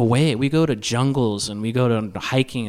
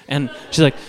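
normal talking voice

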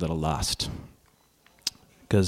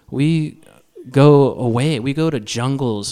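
ordinary talk, nothing marked

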